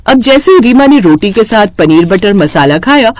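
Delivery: usual speaking voice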